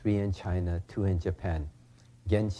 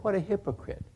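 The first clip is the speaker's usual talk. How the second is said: scoffing students; 6 semitones higher than usual. Retold or imitated. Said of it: "the students, of course, said".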